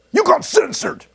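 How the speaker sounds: high, loud gloating shout; triumphant mock jeer; gleeful taunt. Real, not acted. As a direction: crow it at the muted chatter. Mocking a censored chatter.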